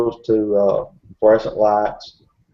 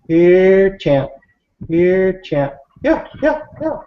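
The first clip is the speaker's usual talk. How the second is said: calling the dog; 9 semitones higher, slower than usual. Retold or imitated. Speaker mimics a distant call heard at camp, like someone calling a dog. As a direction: imitate a far-carrying dog call, pitched way up. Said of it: "like, somebody calling the dog".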